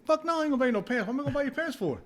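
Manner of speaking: high, fast scoffing refusal; mock outraged refusal. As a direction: scoff at the request. Retold refusal to roommate.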